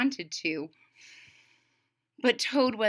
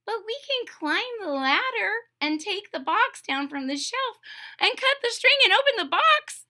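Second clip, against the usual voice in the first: much higher, building toad list; Toad's voice; 11 semitones higher than usual. Acted. Toad listing how to reach the box, much higher voice. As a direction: pile up every step eagerly.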